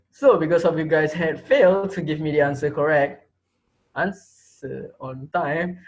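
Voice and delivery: ordinary delivery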